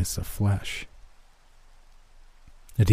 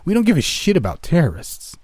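higher, sharp and forceful; angry outburst; 9 semitones higher, 4 dB louder than usual. Acted. Agent snapping back at the laughing narrator. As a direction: spit it back at him in anger.

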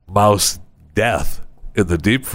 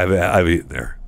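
ordinary talk, nothing marked

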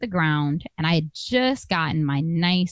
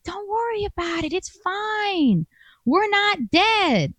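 mock boyfriend voice, much higher and airy; breezy reassurance; 14 semitones higher, slower than usual. Mocking her date as he shrugs off the traffic stop, high and breezy. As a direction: imitate his chipper calm, pitched way up. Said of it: "And he's like".

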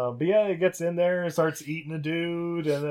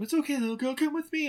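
lightly voiced higher comforting woman; a hint of a reassuring doctor; 12 semitones higher than usual. Podcaster voicing Doctor Laura comforting the girl, higher pitched. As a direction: soothe the little girl. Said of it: "she, like, runs up".

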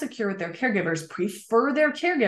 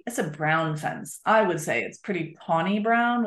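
ordinary delivery

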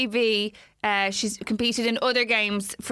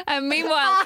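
ordinary talk, nothing marked

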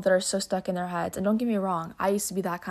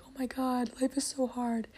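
higher and softer, a light mock whimper of despair; 6 semitones higher, 4 dB quieter than usual. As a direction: play-act a sorry sigh, pitched up and soft. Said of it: "laying in my bed crying".

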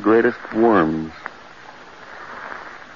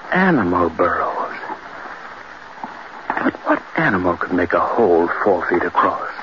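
normal talking voice

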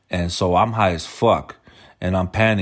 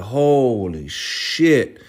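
drawn-out, stunned "Holy shit"; scared slow gasp; 4 semitones higher, much slower than usual. Retold or imitated. A high guy panicking in the car, slow and scared. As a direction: let it hang, frightened and awed. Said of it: "I got scared and I was like".

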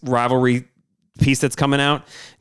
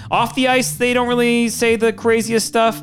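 hyped-up teaser; 11 semitones higher than usual. Acted. Hyping the chirp of the week.

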